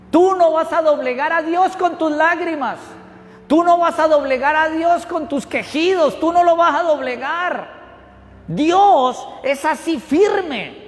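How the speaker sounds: high, forceful sermon declaration; emphatic preaching. Real, not acted. Preacher warning the church about God's firmness, high and emphatic. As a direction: drive each statement home from the pulpit.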